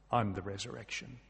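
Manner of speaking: quiet solemnity. Retold or imitated. Jesus answers grieving Martha, quietly solemn. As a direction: drop low and soft for the weighty claim.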